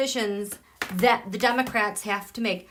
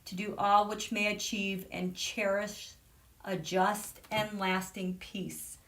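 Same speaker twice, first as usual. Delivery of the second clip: solemn quiet recitation; 4 semitones lower, 8 dB quieter than usual. Acted. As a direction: linger on each word reverently.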